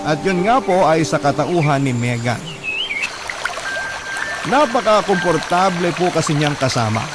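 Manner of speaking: ordinary delivery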